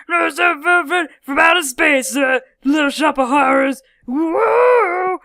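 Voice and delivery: loud, high theatrical shouting; hammed-up monster-plant outburst ending in a howl; mock Venus flytrap. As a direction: play a carnivorous plant, big and loud. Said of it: "where it's just like".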